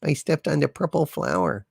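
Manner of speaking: mild mock near-tears confession; almost sobbing. A narrator's reading. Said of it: "almost sobbing".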